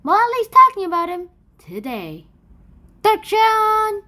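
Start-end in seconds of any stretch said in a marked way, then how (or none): 3.0-4.0 high, loud one-word call; loud announcement. Acted. Kid opens his presentation with a loud announcement. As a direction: announce the title like a game show host. Shouted title card opener.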